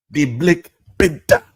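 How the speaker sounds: high-pitched mock African accent; hammed-up African accent on the movie title. Comic echoing his own movie title in a mock African accent.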